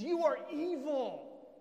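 loud, high, drawn-out condemnation; acted outrage, slow and booming; damning accuser. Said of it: "he begins to accuse you".

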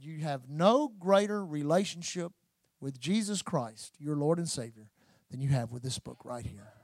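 low, soft, unhurried reverence; hushed solemn preaching. Real, not acted. Preacher closing on Christ as the Word, hushed and solemn. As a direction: let it sink in quietly, with long pauses.

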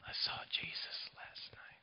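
soft unvoiced whisper, dying man. Dying Leon whispers to the preacher from his hospital bed, a faint whisper. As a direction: breathe it barely audible from a deathbed. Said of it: "he whispers to me".

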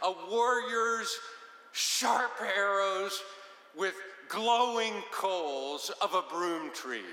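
hammed-up poetic boast; grand party talk. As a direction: declaim it to impress a party. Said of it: "if you talked like that".

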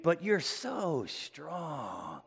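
soft, drawn-out mock admiration; sarcastic. Paul belittling the Corinthians' strength, slowly and softly sarcastic. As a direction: stretch out "so strong" in sarcastic praise. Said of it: "Paul gets really sarcastic here".

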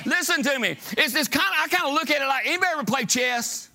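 high, driving sermon delivery; full-force preaching. Real, not acted. Preaching to the congregation.

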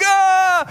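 high, loud scream of fright; terrified scream. Retold or imitated. Disciples screaming as the boat is swamped, terrified scream. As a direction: shriek at the storm. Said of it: "everybody's like".

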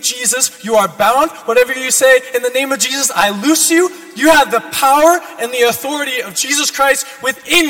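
loud, fervent preaching; preaching at full force. Acted. Sermon builds to full force.

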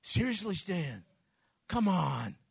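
ordinary delivery

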